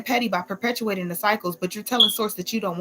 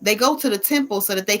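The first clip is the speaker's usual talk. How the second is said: loud, higher preaching; 5 semitones higher, 5 dB louder than usual. Real, not acted. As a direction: preach it with full force. Preaches about letting go.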